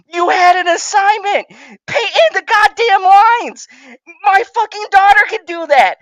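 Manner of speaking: high, exasperated ranting shout; angry yelling. Real, not acted. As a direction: yell it out in real frustration. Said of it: "you've been yelling for".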